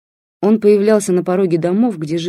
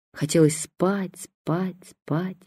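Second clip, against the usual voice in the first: drowsy fading; 6 dB quieter, much slower than usual. A narrator's reading. Drifting to sleep in bed.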